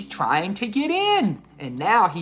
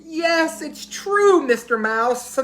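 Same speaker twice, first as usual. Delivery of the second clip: wailing elephant; 10 semitones higher than usual. A narrator's reading. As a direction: wail it like a crying elephant. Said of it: "starts crying".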